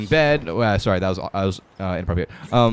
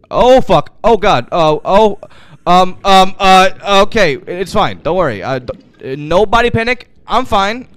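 panic; 5 semitones higher, 7 dB louder than usual. Real, not acted. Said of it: "I'm not panicking".

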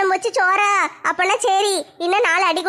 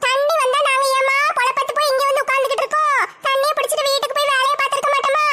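higher, gleeful gossip voice, gloating to herself; delighted busybody savouring the quarrel; sly neighbour's gleeful aside; scheming gossip neighbour; 5 semitones higher than usual. Acted.

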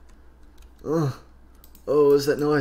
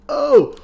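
pained cry; 13 semitones higher, 9 dB louder than usual. Real, not acted. Distress cry after death.

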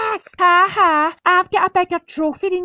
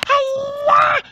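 karate shout; 7 semitones higher than usual. Acted. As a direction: yell it as you leap. Jumping shout.

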